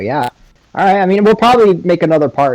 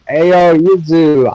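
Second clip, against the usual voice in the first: hyped greeting; 6 semitones higher, 6 dB louder, slower than usual. Acted. Naruto calls out to Yuzu.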